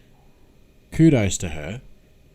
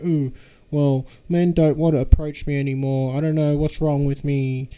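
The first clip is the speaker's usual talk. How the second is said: high, whiny mocking imitation; mock whining woman; 8 semitones higher than usual. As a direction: imitate a woman crying about her dating woes. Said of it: "you get women crying about the same thing".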